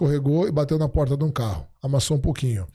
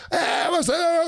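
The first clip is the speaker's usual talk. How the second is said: high mock yell from the kitchen; much slower than usual. Wife yelling at the kids from the kitchen, mock yell. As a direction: shout it out high and sharp. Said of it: "começou a gritar".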